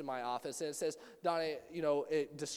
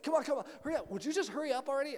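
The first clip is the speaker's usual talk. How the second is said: higher, louder, fast nagging to hurry; impatient rushing; 8 semitones higher, 5 dB louder, much faster than usual. Retold or imitated. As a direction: push someone to move faster. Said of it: "if I feel pushed ... rushed".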